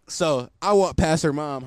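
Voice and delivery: ordinary delivery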